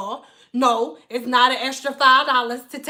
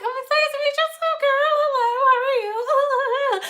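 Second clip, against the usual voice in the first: very high, singsong fake-sweet hello; fake sweet greeting; 12 semitones higher than usual. Stylist greets the client in a high fake-sweet voice. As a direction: lay the sweetness on thick.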